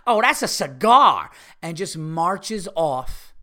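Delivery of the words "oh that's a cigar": loud, slow scoff; dismissive loud brush-off. Retold or imitated. Woman scoffs to her friend about the cigar, loud and slow. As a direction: drag it out loudly and scornfully. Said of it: "she just turns her head quickly to her friend".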